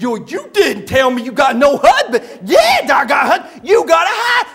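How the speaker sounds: loud, worked-up acted argument; hammed-up indignant back-and-forth, tongue-tied; Buddy. Buddy replays his row with the woman, loud and agitated. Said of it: "when he gets real worked up".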